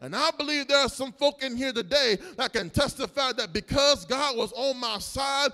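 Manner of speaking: loud preaching over the music, rising to a cry; full-force preaching. Acted. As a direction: preach it out, the crowd with you. Preacher building to a climax.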